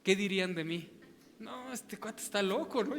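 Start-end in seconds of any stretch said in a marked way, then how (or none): 1.4-3.0 mock churchgoer complaint. Congregants voicing their complaint.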